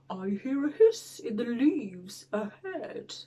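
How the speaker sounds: hushed, whispery creature voice; suspenseful beast whisper; wary monster. Acted. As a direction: whisper it, listening out ahead. Gruffalo hears something ahead, wary.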